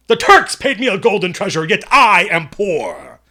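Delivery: loud, high stage orator; hammed-up booming recital, slowing to the end; theatrical declamation. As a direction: declaim it grandly, drawing out the last words. Bursts into a theatrical quote, unprompted.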